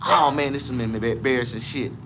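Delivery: usual speaking voice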